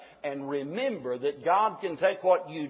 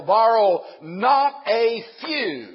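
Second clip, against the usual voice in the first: borrow not a few, slow, louder and higher; slow emphatic reading; 5 semitones higher, 7 dB louder, much slower than usual. Shown as a demonstration. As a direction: read it out slowly, like an underlined line. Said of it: "notice this, and I've underlined it".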